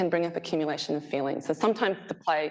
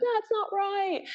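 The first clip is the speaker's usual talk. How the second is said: put-on much higher, childlike protest; child protesting. Child catching a parent misreading the book, high childlike protest. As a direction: squeak it like a kid correcting you. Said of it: "they're like".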